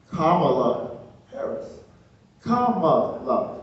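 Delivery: "Kamala" slow, first syllable stressed like "comma"; careful name pronunciation. Retold or imitated. Wife coaching husband on the name, slow careful "Kamala" as comma-la. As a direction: draw out "Kamala" as comma-la, teaching it. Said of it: "make sure you say it right".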